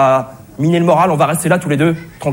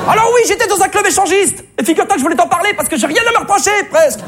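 melodramatic outburst; 12 semitones higher than usual. Acted. Husband blurting his secret to his wife, hammed-up and high. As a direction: ham up the confession like a soap opera.

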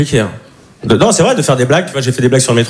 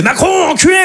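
high, furious yell; angry shout; 13 semitones higher than usual. Retold or imitated. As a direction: scream it in a rage. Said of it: "elle monte dans les tours".

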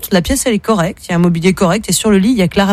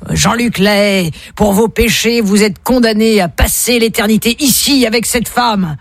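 booming judgement; 3 semitones higher, slower than usual. Acted. Heavenly voice condemning a sinner for eternity, slow and solemn. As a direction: pronounce a condemnation, drawn out. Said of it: "une grosse voix qui dit".

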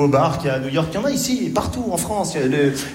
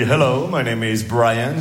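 mock American; 5 semitones lower, slower than usual. American producer introduces himself.

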